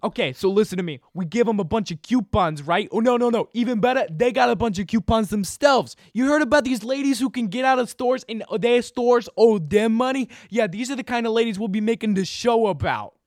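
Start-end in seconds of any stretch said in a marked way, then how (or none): 2.9-13.1 louder, higher, eager sales pitch; excited pitch. Acted. TV producer pitching a show to his boss, excited and loud. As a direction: sell the idea hard, voice up and loud.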